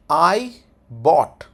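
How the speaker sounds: "I" and "bought" loud, separate words; pronunciation demo. Shown as a demonstration. Teacher showing how it is pronounced, loud and separated. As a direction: pause after "I", then a firm "bought". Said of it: "इसकी pronunciation होगी".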